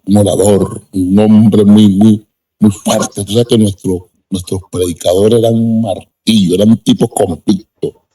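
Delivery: ordinary talk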